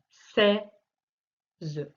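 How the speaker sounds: "Seize" as sei, long pause, ze; split into syllables. Shown as a demonstration. Teacher locating the sound in the first syllable, split into syllables. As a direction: break "Seize" in two, voicing the final e. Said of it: "sur la première syllabe".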